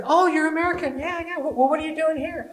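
high-pitched excited curiosity; imitated eager shopper, voice way up; curious stranger. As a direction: gush with surprised interest at a foreigner. Acted curious English shopper.

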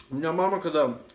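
ordinary delivery

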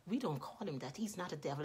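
soft, low, gentle reproof; discreet correction. Retold or imitated. Mother takes the woman aside about her son, quiet correction. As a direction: correct someone quietly and privately.

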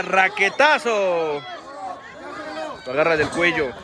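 lively ringside play-by-play; wrestling commentator. Pretend. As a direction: call the match like a lucha announcer.

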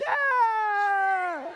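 high, loud, stretched-out scream of alarm; the whole van shrieking at once; van-wide scream. Retold or imitated. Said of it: "The whole van's like".

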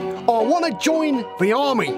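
put-on high-pitched, keen young lad; eager boy recruit. Boy asking the sergeant to enlist, eager. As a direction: announce it proudly, high-voiced.

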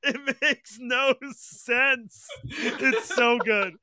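slow words through laughter; laughing while talking, slowly. Real, not acted. Laughing at the video.

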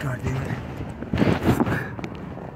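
a whispered curse under the breath; muttered whisper of relief. Real, not acted. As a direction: hiss it quietly while hiding. Peeing in hiding, nervous.

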